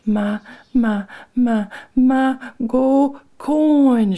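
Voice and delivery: high, loud, drawn-out wail; wailing child. A narrator's reading. A child crying to say her piece, wailing. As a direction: wail it out, syllable by syllable. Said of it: "she began to howl some more".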